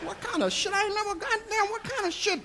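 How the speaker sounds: stunned, half-swallowed cursing; muttered to myself. Retold or imitated. Talking to himself after being told to carry the bag. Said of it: "I said this to myself".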